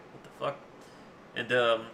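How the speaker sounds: usual speaking voice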